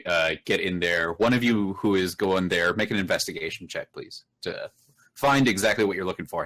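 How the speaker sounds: normal talking voice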